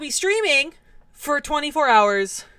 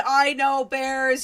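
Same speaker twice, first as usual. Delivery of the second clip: ordinary talk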